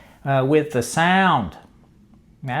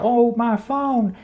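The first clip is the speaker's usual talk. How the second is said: higher, drawn-out mild mock panic; mock freak-out; 7 semitones higher than usual. Woman seeing her phone in the water, mild mock freak-out. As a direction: act lightly distraught, stretching the words. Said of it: "She's freaking out".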